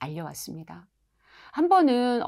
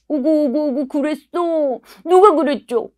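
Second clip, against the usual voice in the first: overdone sympathy; 8 semitones higher, 8 dB louder than usual. Shown as a demonstration. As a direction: coo far too much over the child. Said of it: "공감 표현은 또 너무 과도하게". (과하게 오구오구 달래는 말투)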